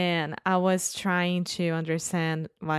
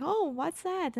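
high, softer acted interest; keen curiosity; 10 semitones higher, 6 dB quieter than usual. Friend paying close attention to waiter, curious. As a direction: lightly mimic an eager listener. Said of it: "He was super paying attention".

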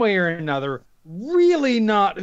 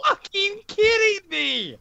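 disbelief; 13 semitones higher than usual. Real, not acted. Shocked at a bad roll.

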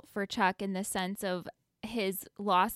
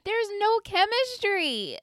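pitched-up exasperated complaint; 9 semitones higher than usual. Real, not acted. Co-host venting about a dull TV couple, exasperated. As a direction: draw out NO in high exasperation.

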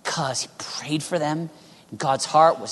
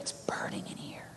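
whispered from deep inside; very soft, voiceless and earnest; hushed intensity; 17 dB quieter than usual. Acted. Voices burden felt inside.

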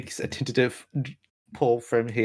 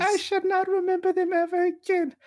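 much higher, squeaky little orphan; high child voice. Acted. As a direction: play a wide-eyed little orphan boy. Orphan replies to nun.

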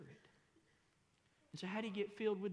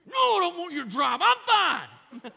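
much louder, higher insistence that he's fine; testy shout; 13 semitones higher, 19 dB louder than usual. Retold or imitated. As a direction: bite back at the wife who offered to drive. Snapping back at his wife.

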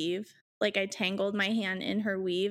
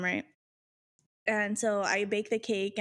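ordinary talk, nothing marked

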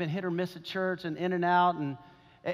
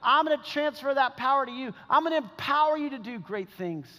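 raised, emphatic pulpit delivery; impassioned preaching; 6 semitones higher, 3 dB louder than usual. Acted. Preacher voicing a promise of power, impassioned. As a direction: preach it with rising fervor.

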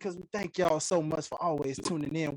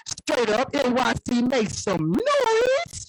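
loud circus ringmaster intro; 4 dB louder than usual. Acted. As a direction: boom it out to the crowd. Hype intro of guests.

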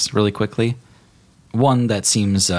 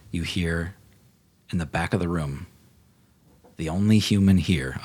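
ordinary talk

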